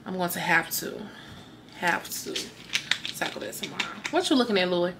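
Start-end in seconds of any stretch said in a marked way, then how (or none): none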